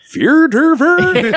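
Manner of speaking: high, loud puppet gibberish; Swedish Chef. Acted. Puppet chef answers the challenge, comic gibberish voice. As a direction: trill out nonsense syllables in a comic high voice. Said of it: "Swedish Chef goes".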